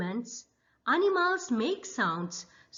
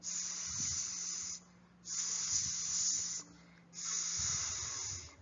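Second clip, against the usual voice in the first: long soft hissing s; quiet sustained hiss; snake hiss; 12 dB quieter, much slower than usual. Acted.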